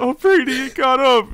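high, loud excited call to Brady; excited retold call. Telling Brady the character stood up, excited high call. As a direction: call out to your friend in delight. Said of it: "I'm like".

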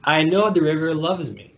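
plain reading voice

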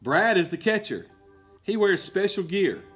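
usual speaking voice

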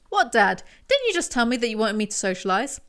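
ordinary talk, nothing marked

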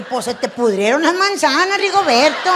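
loud taunting jab; loud mocking retort. Wife mocks her husband, loud and taunting. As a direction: shout the taunt with a laugh.

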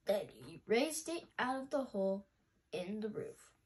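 usual speaking voice

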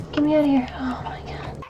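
panicked whisper. Real, not acted. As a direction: breathe it out in dread. Stuck in water, panicking.

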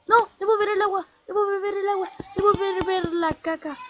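high, fast silly voice; mock character voice. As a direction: play the little character, squeaky. Voicing Isaac character lines. (voz aguda de personaje, burlona)

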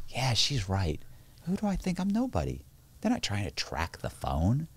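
soft, self-mocking inner voice; inner thought. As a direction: mutter it to yourself, deflated. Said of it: "And I remember thinking".

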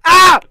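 sudden startled scream. Real, not acted. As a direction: yelp in shock. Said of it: "I'm just screaming my butt off today".

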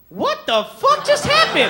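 very high, loud, slow disbelief; baffled guy. Retold or imitated. Comedian voicing every guy in the room, high and stunned. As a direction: shriek in utter confusion. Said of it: "I speak for every guy".

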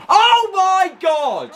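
long, high, drawn-out cry; real joy yelled high and slow; elated shout. Real, not acted. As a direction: let it burst out in disbelief. Watching own goal go in.

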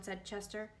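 plain reading voice

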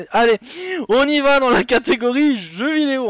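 high, loud launch of the category; hyped host. Real, not acted. Quiz host firing up the chat, big excited announcement. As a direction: announce it like a game-show host.

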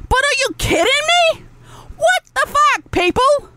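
high, incredulous outburst; exasperated disbelief. Real, not acted. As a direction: throw your hands up at them. Exasperated rant at viewers.

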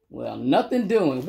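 ordinary delivery

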